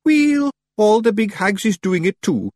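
high, slow Scots voice; high-pitched Scottish character voice; defensive Feagle leader. A narrator's reading. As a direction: protest as a wee Scotsman, drawn out.

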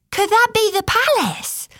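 put-on very high little-girl voice; high, wide-eyed question; curious child. A narrator's reading. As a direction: ask like an eager small girl. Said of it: "asked Percia".

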